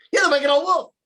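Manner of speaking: high, loud accusing shout; girl's accusing cry. Acted. Girl names the wolf, high loud accusing shout. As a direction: point and yell it at the wolf. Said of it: "and she said".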